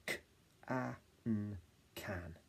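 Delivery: K-A-N then can; spelling out. Shown as a demonstration. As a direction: sound letters then blend can. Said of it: "My turn".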